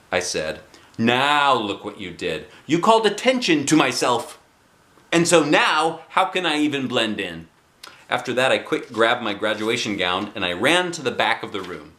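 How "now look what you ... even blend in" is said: put-on a little girl fussing at her friend; Junie B. scolding.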